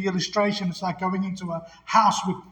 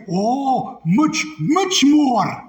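high, loud, drawn-out Scottish advert reply; Scottish accent; 6 semitones higher, 5 dB louder, much slower than usual. Retold or imitated. Answering the question in the old commercial, Scottish accent. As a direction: relish it in a broad mock Scottish accent. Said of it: "advertised with a Scottish accent".

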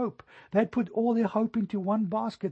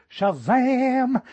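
a sudden high, loud "Shazam!"; shouted reveal; 6 semitones higher, 7 dB louder than usual. Pretend. Jesus shouting a reveal to the clueless disciples, loud and high. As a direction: burst it out like a magic trick. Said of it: "He could have right in that moment shouted".

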